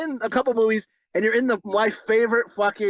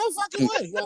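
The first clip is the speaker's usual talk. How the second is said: high "No fucking way" in mock amazement; retold disbelief at the cheetah movie; amazed disbelief; 14 semitones higher than usual. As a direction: act stunned by the news. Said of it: "And I was like".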